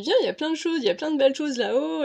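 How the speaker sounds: high, loud, coaxing call; eager urging. Retold or imitated. To her grandfather, begging him to come: high eager coaxing. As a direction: pull him along with bright, excited urgency.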